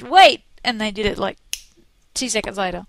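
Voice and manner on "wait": loud shout of wait; loud insistent shout. Retold or imitated. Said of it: "I said".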